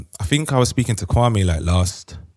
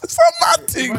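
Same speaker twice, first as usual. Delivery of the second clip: high comic shout; slower than usual. Pretend.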